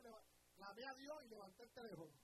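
ordinary talk, nothing marked

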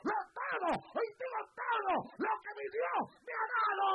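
very loud, high pulpit shout; defiant, fervent preacher's roar; full-force preaching. Real, not acted.

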